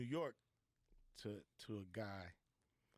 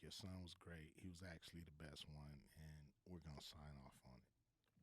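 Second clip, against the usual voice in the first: hushed praise; 6 semitones lower, 12 dB quieter than usual. Retold or imitated. Studio head's good news.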